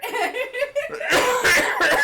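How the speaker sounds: long, loud, high laugh; real laughing fit; bursting laugh. Real, not acted. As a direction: crack up at your own mistake. Laughing at own mistake.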